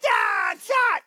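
high, loud yelp of pain; hammed-up burnt-mouth cry; Daddy Bear's yelp. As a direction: yelp with a scalded tongue. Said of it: "Daddy Bear eats some porridge".

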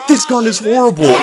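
high, loud complaint; loud gamer outburst; frustrated shout. Real, not acted. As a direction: yell it in exasperation. Complains mid-fight.

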